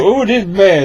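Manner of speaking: high, loud mock disgust. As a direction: ham up a bad taste. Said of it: "immediately goes".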